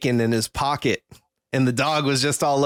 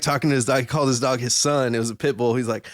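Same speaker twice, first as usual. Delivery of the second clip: ordinary talk, nothing marked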